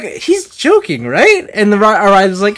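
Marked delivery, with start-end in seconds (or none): 0.0-1.5 high, drawn-out incredulous question; loud mock-incredulous check, rising at the end; retold disbelief. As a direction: stretch it out in high-pitched disbelief. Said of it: "So we were all like".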